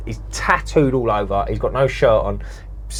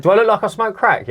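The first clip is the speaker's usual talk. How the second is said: higher-pitched mock indignation; indignant refusal; 7 semitones higher, 4 dB louder than usual. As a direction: retell it as an affronted comeback. Retold indignant refusal to the dealer.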